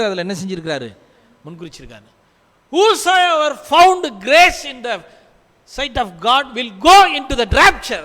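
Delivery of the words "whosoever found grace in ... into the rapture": loud, high, drawn-out proclamation; ringing declaration, key words punched; full-force preaching. Real, not acted. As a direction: preach it out to the whole congregation.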